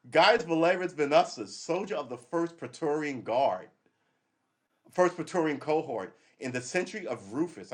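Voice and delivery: announcing a Roman soldier's boast. Shown as a demonstration. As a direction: read it out like a carved epitaph. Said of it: "reading inscription".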